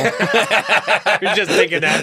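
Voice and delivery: slow, loud laughing retort. Real, not acted. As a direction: laugh through the words, drawn out.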